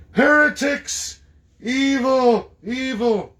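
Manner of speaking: high, loud, slow condemnation; mock zealot. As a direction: play a scandalised zealot. Parodies zealots condemning heretics.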